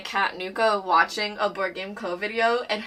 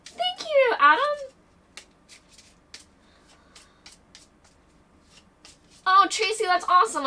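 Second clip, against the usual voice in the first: much higher, bubbly thanks; excited thanks; 11 semitones higher, 4 dB louder than usual. Real, not acted. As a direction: gush thanks to the chat. Greeting chat donors excitedly.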